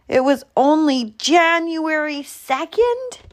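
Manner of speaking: high, rising disbelief; shocked. A narrator's reading. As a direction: read it as a startling discovery. Squirrel's shocking discovery on calendar.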